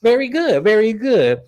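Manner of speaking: high, sing-song mock praise, slowing on okay; mocking sarcastic. [name] mocking her coworker with high sarcastic praise. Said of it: "Stacy's like".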